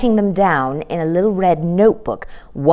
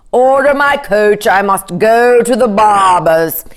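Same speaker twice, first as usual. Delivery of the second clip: a touch of grand king, louder; magnificent; 3 semitones higher, 5 dB louder than usual. A narrator's reading. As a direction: command it like a vain monarch. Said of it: "he said magnificently".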